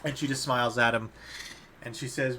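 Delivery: ordinary talk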